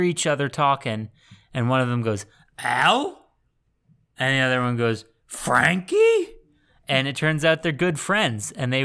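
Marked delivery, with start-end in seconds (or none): 2.6-3.3 put-on questioning call to an old pal; acted-out surprised hail; tentative recognition. Voices gunmen recognizing each other. 5.3-6.3 high, questioning call; rising, higher-pitched acted hail; surprised recognition. Voices gunmen recognizing each other.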